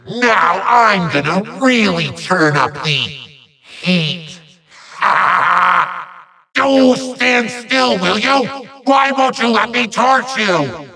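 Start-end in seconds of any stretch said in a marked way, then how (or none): 5.0-6.0 short villainous cackle; evil laugh. Acted. Villain turns up the heat, gloating cackle.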